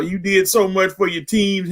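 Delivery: ordinary talk, nothing marked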